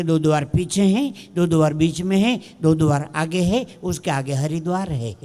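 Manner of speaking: low, sing-out Hindi safety briefing; cabin announcer. Acted. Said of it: "એ announcement કરે".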